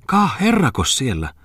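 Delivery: high, drawn-out cry of surprise; astonished exclamation. A narrator's reading. Miller's wife spotting a gentleman upstairs, astonished exclamation. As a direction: exclaim slowly, pitched well up. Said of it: "huudahti myllärin emäntä".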